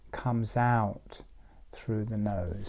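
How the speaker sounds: whispered exhale cue; breathy whisper following the out-breath. Shown as a demonstration. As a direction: let the words out on the breath.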